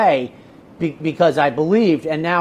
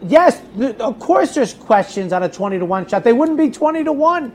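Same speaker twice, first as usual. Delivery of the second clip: higher-pitched, insistent comeback; emphatic retort; 7 semitones higher than usual. Retold or imitated. Handicapper answering doubts about a long shot, emphatic retort. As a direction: argue the obvious back, pitched up. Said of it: "so I'm like".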